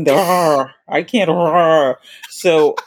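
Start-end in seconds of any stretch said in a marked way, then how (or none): 0.1-0.7 rrr as a trilled rolled R. Shown as a demonstration. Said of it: "It's the rolling". 1.3-1.9 rrr as a trilled rolled R. Shown as a demonstration. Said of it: "It's the rolling".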